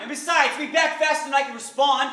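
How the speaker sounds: high, loud, indignant excuse; defensive outburst. Acted. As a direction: argue back hotly in self-defence. Acted quarrel, he defends himself.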